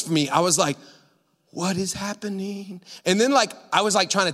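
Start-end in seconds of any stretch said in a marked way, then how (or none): 1.5-2.8 soft, slow, stunned question; dazed disbelief. Retold or imitated. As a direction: say it softly, lost in bewilderment. Reenacts his dazed disbelief.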